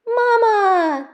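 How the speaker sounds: put-on child's astonished cry; gasping cry. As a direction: cry out in sudden joy at seeing her. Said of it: "Gus gasps".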